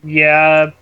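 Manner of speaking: ordinary talk, nothing marked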